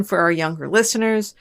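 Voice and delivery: ordinary delivery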